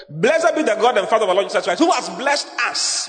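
lifted, ringing reading of the verse; scripture reading. Acted. Pastor reading verse to the church, lifted and ringing. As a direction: read the blessing out like a proclamation. Reads verse three aloud.

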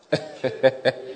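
brief, breath-led laugh; short chuckle. Real, not acted. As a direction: chuckle briefly between two points. Preacher chuckles between points.

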